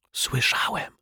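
hushed, voiceless answer; whispered reply. A narrator's reading. Mr Mietek answers the detective, whispering. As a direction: whisper it straight back. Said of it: "odszepnął pan Mietek".

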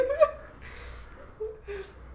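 high laughing; laughter. Real, not acted. Laughing with a friend about the game, laughter.

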